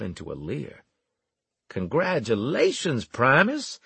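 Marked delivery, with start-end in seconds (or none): 1.7-3.8 high, loud congratulations; loudly mock-impressed, pitched up. A narrator's reading. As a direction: announce it for all to hear. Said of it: "I said loudly".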